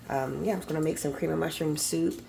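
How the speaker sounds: usual speaking voice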